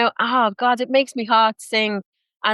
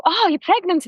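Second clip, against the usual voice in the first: knowing announcement; 9 semitones higher than usual. Retold or imitated. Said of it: "I'll go".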